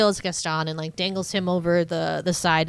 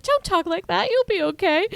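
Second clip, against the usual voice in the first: high mock pleading Belle; squeaky, earnest heroine voice; Belle comforting; 12 semitones higher, 3 dB louder than usual. Belle to dying beast, high mock comforting. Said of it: "she's like".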